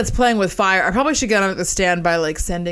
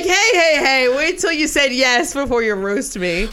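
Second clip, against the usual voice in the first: high, loud laughing protest; impression of a friend protesting, laughing; mock protest as him; 5 semitones higher, 3 dB louder than usual. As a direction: play him begging not to be roasted yet. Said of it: "You're like".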